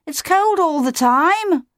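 high, drawn-out chick's squeak. A narrator's reading. Otto protesting to Claudius about the cold, squeaking. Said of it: "squeaked Otto".

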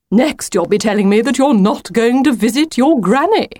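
indignant. A narrator's reading. Wolf challenging Lucy about visiting granny, indignant. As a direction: protest with mounting disbelief. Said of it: "said the wolf indignantly".

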